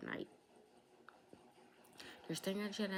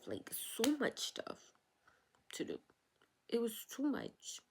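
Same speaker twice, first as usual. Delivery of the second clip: overwhelmed; 6 semitones higher, slower than usual. Real, not acted. Overwhelmed by events.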